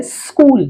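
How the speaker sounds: "स्कूल" with a long sss at the start; S sound shown. Shown as a demonstration. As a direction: hold the first sound of "स्कूल", sss-kool. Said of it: "एस का आवाज देखिए". ("स्कूल" में शुरू का स् लंबा)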